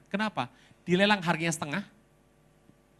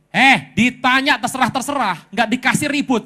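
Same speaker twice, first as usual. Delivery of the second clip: loud, high-pitched mock telling-off; exasperated scolding; 6 semitones higher, 11 dB louder than usual. Pastor scolds husband for leaving it to God, exasperated. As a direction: scold him for dodging the question.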